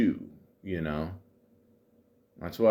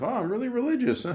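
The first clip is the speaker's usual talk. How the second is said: high, quick mocking imitation of a religious dater; mock churchy voice; 13 semitones higher, faster than usual. Mocking a religious dater in a high prissy voice. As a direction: toss it off in a prim pious falsetto.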